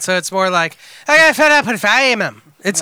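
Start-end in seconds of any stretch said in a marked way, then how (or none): 1.1-2.4 a lilting Welsh accent, a touch louder; Welsh accent demo. Shown as a demonstration. Correcting a friend's accent, showing a Welsh lilt. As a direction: show how the Welsh mother really sounds. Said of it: "it's a Welsh accent".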